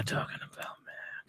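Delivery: whispered to himself, no voice. Real, not acted. Correcting himself about the show, whispered. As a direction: mutter it under your breath. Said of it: "What am I talking about?".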